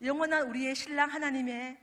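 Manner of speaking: normal talking voice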